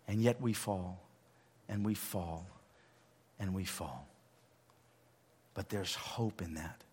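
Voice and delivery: fading to a whisper. Acted. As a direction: let it die away, then whisper the hope.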